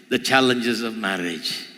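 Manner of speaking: normal talking voice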